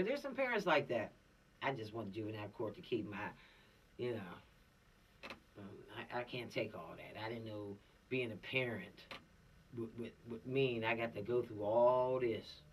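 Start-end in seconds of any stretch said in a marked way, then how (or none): 1.6-12.6 lower, softer, helpless mock parent voice; a worn-out parent giving up, low and soft; overwhelmed parent.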